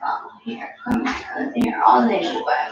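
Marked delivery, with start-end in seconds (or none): 0.1-1.5 hushed whisper, barely voiced. Real, not acted. Hushing the baby near sleep.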